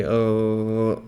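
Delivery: a gargled throaty uh from the back of the throat; gargling throat sound. Shown as a demonstration. Teacher showing ghain by gargling, throaty rasp. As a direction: gargle it out like rinsing. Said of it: "'cause when you gargle".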